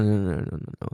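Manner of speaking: usual speaking voice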